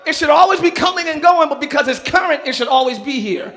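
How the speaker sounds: loud, high shouting sermon; whooping preacher climax; preaching at full force. Real, not acted. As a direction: drive it home with full fire.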